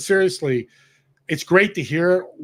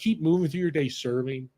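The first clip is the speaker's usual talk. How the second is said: usual speaking voice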